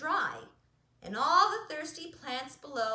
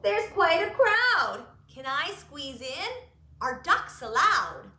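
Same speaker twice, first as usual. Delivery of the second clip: duck voice; 3 semitones higher, 10 dB louder than usual. Acted. Duck asks to squeeze in, loud. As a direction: squeeze in as a pushy little duck. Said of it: "said duck".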